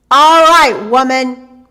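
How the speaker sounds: booming giant's bellow, loud. A narrator's reading. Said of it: "bellowed the giant at the door".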